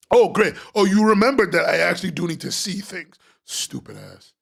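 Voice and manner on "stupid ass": low, soft muttered jab; muttered insult. Real, not acted. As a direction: grumble it under your breath.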